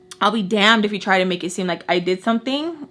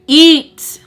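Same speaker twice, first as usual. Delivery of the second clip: loud, higher command; barked order; 7 semitones higher, 9 dB louder than usual. Retold or imitated. Father ordering his daughter to eat, loud command. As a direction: snap the order at her.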